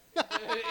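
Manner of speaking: high loud laugh; real laughter at the memory; loud burst of laughter. Real, not acted. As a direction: laugh out hard. Laughing at retold story.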